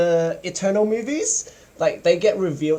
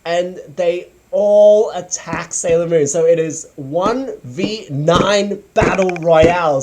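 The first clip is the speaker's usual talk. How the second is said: ordinary talk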